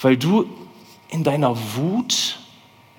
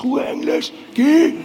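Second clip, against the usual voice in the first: calling out; 10 semitones higher than usual. Acted. Shouting at a noise.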